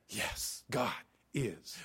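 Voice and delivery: barely voiced, breathy faint yes; faint whispered reply. Pastor imitating his paralyzed friend's faint answer. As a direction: whisper it like a man who can barely speak.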